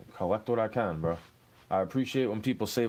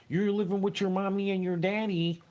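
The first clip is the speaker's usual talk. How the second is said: higher, sing-song mockery; mocking internet guy; 7 semitones higher than usual. Quoting an internet guy who mocks him for living with parents, higher and mocking. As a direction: poke fun at the smug commenter. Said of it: "You tell this dude on the internet's like".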